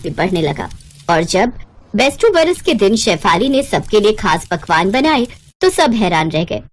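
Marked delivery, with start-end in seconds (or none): none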